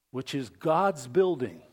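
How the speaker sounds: ordinary delivery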